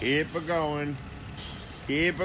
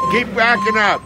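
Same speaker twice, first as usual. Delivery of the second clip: calling out; 8 semitones higher, 3 dB louder than usual. Real, not acted. Directing driver.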